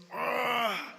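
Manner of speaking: a bright, joyous cry of "Ah"; joyful gasp. Retold or imitated. Juan in the prison rejoicing at the news: glad "Ah". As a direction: let out one glad "Ah" of relief.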